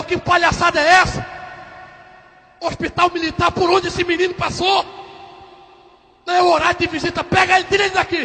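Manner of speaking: high, indignant outburst; indignant doctor. Acted. Military doctor ordering the boy removed, indignant high voice. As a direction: demand it like an outraged officer.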